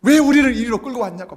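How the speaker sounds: imitating a loud, weepy protest; mock wailing complaint. Israelites wailing at God, mock weepy protest. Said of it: "막 엉엉 울면서".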